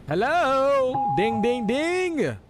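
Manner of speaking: high, loud singsong doorbell imitation; calling out. Delivery driver calling at the customer's door, singsong. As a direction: sing out a playful high doorbell call.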